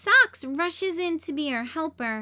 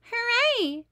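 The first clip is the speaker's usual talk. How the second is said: high, loud cheer; excited cheer; 10 semitones higher, 5 dB louder than usual. Acted. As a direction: shout it for joy. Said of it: "cry the kids".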